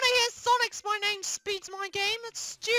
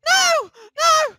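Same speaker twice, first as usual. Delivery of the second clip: protesting shouts; 3 semitones higher, 8 dB louder, much slower than usual. Acted. Link hears of a harder level, protesting shouts. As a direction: shout each no in dread.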